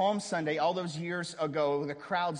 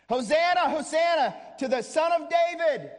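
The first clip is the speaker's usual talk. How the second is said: higher, louder shout of praise; crowd shouting; 13 semitones higher, 9 dB louder than usual. Retold or imitated. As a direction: call it out as if to the whole street. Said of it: "They're shouting it".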